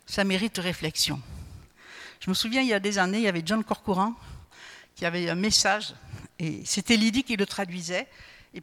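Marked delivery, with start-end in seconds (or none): none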